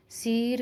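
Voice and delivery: "सिर" said with a long ee, "seer"; wrong long vowel. Shown as a demonstration. Teacher warning against the big ई, "सिर" with long ee. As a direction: lengthen the short i of "सिर" into ई. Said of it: "सिर करके और खील करके नहीं बोलेंगे".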